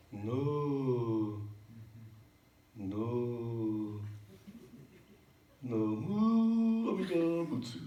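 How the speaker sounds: long chanted syllables with a big jump on "mu"; stress on mu. Pretend. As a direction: labour slowly into "Namu", then punch the "mu". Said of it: "put the emphasis on the mu".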